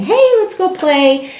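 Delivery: bright sing-song call to distract the dog; cheerful redirect. Acted. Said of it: "instead of saying".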